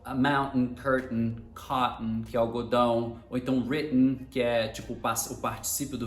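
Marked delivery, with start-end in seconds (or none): 0.2-2.2 "mountain, curtain, cotton" each with the T caught in the throat before n; low word list, "cotton" as co'n; glottal stop. Shown as a demonstration. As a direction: cut the air in the middle of "mountain, curtain, cotton". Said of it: "quando o glottal stop acontece no meio".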